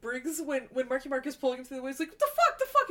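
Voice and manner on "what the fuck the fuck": high, loud yelling of what the fuck; hammed-up shocked shout; panicked thug shouting while dragged; mock outraged yell. Retelling Briggs dragged through woods.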